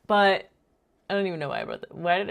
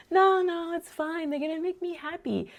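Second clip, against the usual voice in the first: put-on high-pitched little-girl voice; imitated young daughter; 12 semitones higher than usual. Daughter reassures mom about the dog pictures, high imitated protest. Daughter answering back in story.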